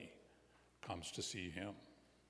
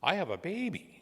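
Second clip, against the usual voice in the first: high-pitched little character voice; Robin answering; 9 semitones higher than usual. A narrator's reading. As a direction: answer up high, as the child in the story. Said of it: "And Robin says".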